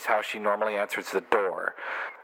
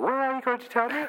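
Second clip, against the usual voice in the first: much higher, put-on female voice; mock woman's voice; 13 semitones higher than usual. Film woman questions the man about his secret, mock high voice. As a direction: play her in a high-pitched voice. Acting both sides of film dialogue.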